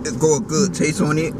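ordinary talk, nothing marked

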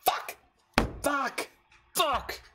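high, pained curses at his own misplay; cursing himself out after a blunder, pitched high; frustrated curse. Real, not acted. Player cursing his misplay mid-match, high and frustrated. Misplays against opponent's card.

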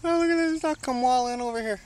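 high, sing-song pet voice; cooing at the duck. Real, not acted. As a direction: coo at the duck like it's a pet.

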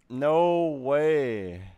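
usual speaking voice

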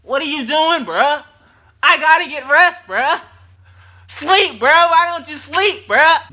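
high, loud whiny voice of angry muscles; talking muscles. Pretend. Sore muscles yelling at the lifter in a high loud voice. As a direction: squeal it like your sore muscles are yelling at you. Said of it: "your muscles is like".

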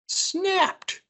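high, sudden storytelling jolt; high-pitched dramatic narration; dramatic snap. A narrator's reading. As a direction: make the branch break suddenly.

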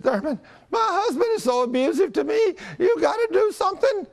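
very high, hammed-up wail; mock crying. Upset wife begging the preacher for help, exaggerated crying. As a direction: imitate a wailing wife pleading for help. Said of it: "and she's crying".

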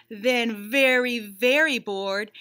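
"very" stretched slowly, drawn-out "very bored"; stress on very. Acted. Bunny showing the reader how bored he got, drawn out. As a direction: drag out "very" as boredom grows. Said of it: "He has, like, a bored meter".

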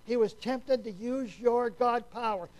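ordinary talk

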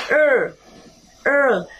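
"ơ" said as a dark, swallowed L; dark L sound. Shown as a demonstration. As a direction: say "ơ" the way the dark L at the end of a word sounds. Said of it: "Cách đọc thứ hai".

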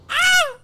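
very high loud war cry; battle scream. Real, not acted. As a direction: scream charging in. Charging in epically. (grito de guerra)

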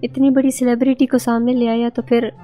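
usual speaking voice

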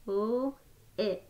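each sound said separately with a short break; sounds tapped apart. Shown as a demonstration. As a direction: split the word into its sounds, one by one. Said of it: "how many sounds are in the word lip".

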